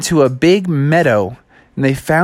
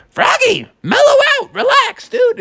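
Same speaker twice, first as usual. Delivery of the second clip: a much higher mom telling him to chill, dude. Acted. Mom calming impatient Froggy.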